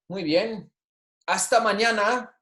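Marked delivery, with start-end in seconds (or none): 1.3-2.3 Hasta mañana raised high; bright high model. Shown as a demonstration. Teacher to class, high bright hasta mañana. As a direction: lift it up for the class. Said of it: "saying these with me".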